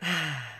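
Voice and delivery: long breathy exhale; heavy sigh. Real, not acted. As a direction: let out one long sigh. Exhales before describing flooding.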